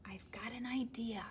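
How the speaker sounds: soft whispered aside; conspiratorial whisper. Acted. Reader shares a secret plan with listeners, whispered. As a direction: lean in and whisper.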